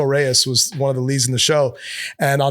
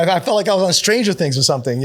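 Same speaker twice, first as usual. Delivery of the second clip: ordinary delivery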